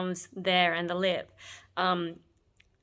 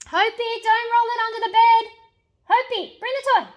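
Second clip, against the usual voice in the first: pet-talk voice; 11 dB louder than usual. Real, not acted. Talking to pet rabbit/guinea pig.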